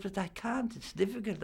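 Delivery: high-pitched mock pleading; retold protest. Actor objecting to playing himself, retold protest. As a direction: replay a helpless protest, pitched well up.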